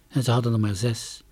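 usual speaking voice

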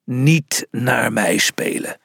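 slow lion's growl; slow, growled refusal. A narrator's reading. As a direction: grumble it slowly like a lion. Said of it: "gromde de leeuw".

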